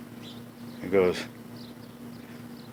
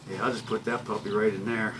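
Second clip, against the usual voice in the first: ordinary delivery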